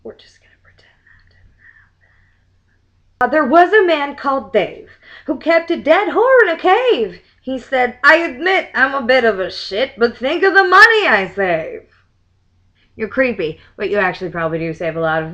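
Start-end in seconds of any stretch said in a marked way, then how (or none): 0.0-3.2 hushed embarrassed aside; softly whispered cover-up. Real, not acted. Aside after a stray clip played. 3.3-11.9 louder, higher bouncy verse reading; lively verse recital; limerick reading. Acted. Reads limerick aloud.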